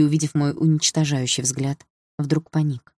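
usual speaking voice

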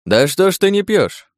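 high, insistent question; surprised challenge. Acted. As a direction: press the host in a high, surprised voice. Подьячий пристаёт к непьющему хозяину. (удивлённый вопрос высоким голосом)

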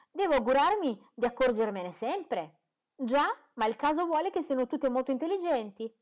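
put-on a fussy mother stoutly defending her girls; indignant mother. A narrator's reading.

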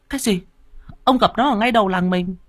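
high incredulous wife; can't believe it, voice leaps up; shocked wife. Acted. Wife hears he met the ghost, high and incredulous.

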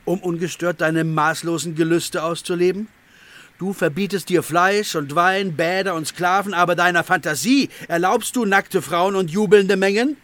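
accusing taunt. Acted. Hilarion mockingly accuses the hermit of lust. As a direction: lash him with his hidden desires.

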